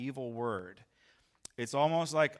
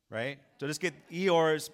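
ordinary talk

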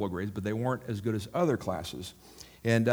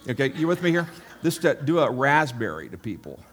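louder, higher, rallying the crowd; fired up; 4 semitones higher, 6 dB louder than usual. Real, not acted. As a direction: pump the congregation up. Hyping the crowd.

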